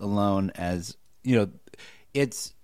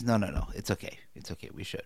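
hushed quote; 5 semitones lower, 13 dB quieter, faster than usual. Retold or imitated.

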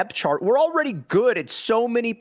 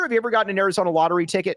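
usual speaking voice

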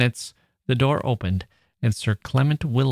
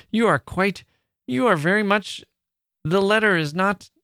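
high-pitched broken starts, each cut short; flustered stammering; 9 semitones higher than usual. A narrator's reading. Young woman caught out over the letter, flustered stammering. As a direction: falter and restart, flustered. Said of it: "you stammer".

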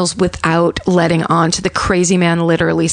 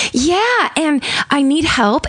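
high, sweet and calm, hiding panic; cheery tone over a desperate plea; lightly voiced pleasant caller; calm victim voice; 8 semitones higher, slower than usual. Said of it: "the words she's saying don't match her tone".

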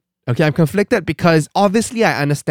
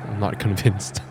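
ordinary talk, nothing marked

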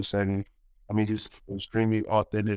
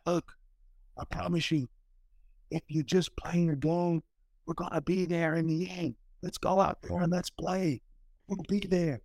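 raised, soft, choked-up coach voice; a coach's soft pep talk, voice raised and hushed; imitating the coach; 8 semitones higher, 3 dB quieter than usual. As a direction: voice the coach's emotional promise gently. Coach's tearful huddle talk quoted.

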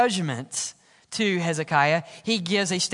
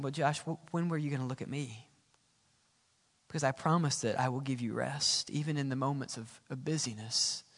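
gentle voice of God; 9 semitones lower, 13 dB quieter than usual. Acted. Preacher voices God answering him.

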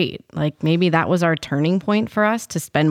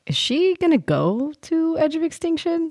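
high, wondering question; wondering aloud; 12 semitones higher than usual. Retold or imitated. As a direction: ask it like a sudden wonder. Recalling wondering about Reem's fate.

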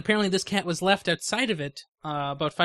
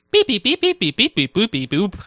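high loud beeps; beeping noises; 3 semitones higher, 9 dB louder than usual. Acted. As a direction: beep it out like a machine. Imitates marker beeping.

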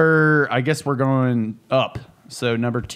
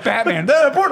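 excited announcer; 12 semitones higher, 4 dB louder than usual. Pretend. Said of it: "I'm like".

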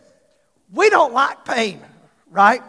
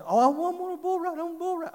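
put-on higher, childlike pleading voice; eager little boy; 7 semitones higher, 5 dB quieter, faster than usual. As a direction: play the excited young son begging. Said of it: "No boys".

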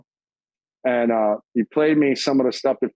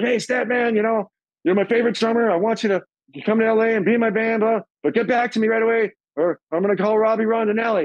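much higher, eager voice of a friend; imitating Chris; 7 semitones higher than usual. Chris on the phone begging his favorite drummer, high and eager. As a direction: pitch it up and sell the offer. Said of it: "He goes".

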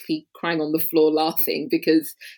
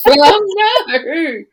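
laughing no, pitch jumps higher and louder; laughing protest; 12 semitones higher, 10 dB louder than usual. Real, not acted. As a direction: laugh off the request. Asked to do American accent.

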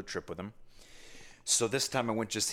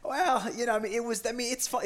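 much higher, sheepish defense; defensive high. Real, not acted. Answering the host's teasing, high and sheepish. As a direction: squeak out the defense. Defending fun, pitch jump.

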